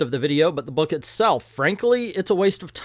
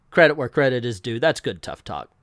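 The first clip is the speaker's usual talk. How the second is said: ordinary delivery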